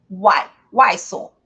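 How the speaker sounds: Y said as a short, loud Brazilian i; y like mineiro i. Shown as a demonstration. Teacher showing Y sound like a mineiro. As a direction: clip the Y to a plain ee sound. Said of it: "É exatamente assim".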